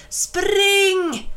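high, loud calling shout; shout from afar. A narrator's reading. Jula yelling to the children to run, loud high shout. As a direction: bellow across the snow. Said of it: "hörde han Jula skrika".

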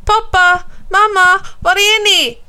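high, loud worried call. A narrator's reading. Alf calls for his absent parents, calling out. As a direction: shout to find missing parents. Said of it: "ropar Alf".

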